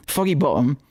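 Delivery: lightly put-on Cockney accent; mock Cockney with a glottal stop. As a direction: say it like a Cockney, dropping the t in "Bottom". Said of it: "we'll say in a Cockney accent".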